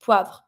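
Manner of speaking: "poivre" as one syllable, pwavr; short falling "poivre" with no extra vowel; joined V R. Shown as a demonstration. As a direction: connect V and French R at the end of "poivre". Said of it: "connect all of the consonant together".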